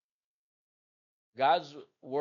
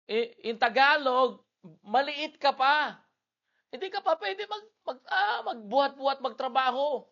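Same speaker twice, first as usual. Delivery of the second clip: much higher, loud preacher's plea; high preaching; 10 semitones higher, 7 dB louder than usual. Acted. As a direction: pitch it way up as you scold the little ones. Preaching to children in Tagalog. (mataas na boses ng pangangaral)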